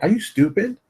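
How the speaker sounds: high-pitched, disbelieving question; incredulous challenge to their sense. Retold or imitated. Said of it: "I just look at them like".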